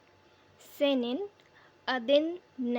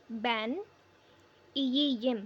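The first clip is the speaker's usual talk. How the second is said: ordinary talk, nothing marked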